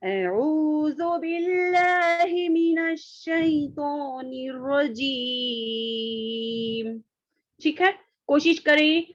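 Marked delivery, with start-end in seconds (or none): 0.0-7.0 slow, higher, drawn-out correct Arabic with ain and zal; correct recitation. Shown as a demonstration. Teacher showing the class the right recitation, slow and sung. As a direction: recite it the correct way, stretching रजीम. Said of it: "ये दुरुस्त".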